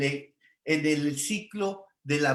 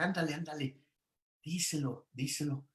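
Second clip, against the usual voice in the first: hushed, softer nudge to the father; soft urging; 9 dB quieter than usual. Retold or imitated. Coaxing the father to speak to his son, hushed. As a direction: quietly nudge him to say it.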